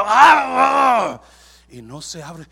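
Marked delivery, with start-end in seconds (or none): 0.0-1.2 high, very loud drawn-out scream; struggling roar. Acted. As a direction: roar as if battling a stuck door. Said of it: "está batallando con la". (alarido de esfuerzo)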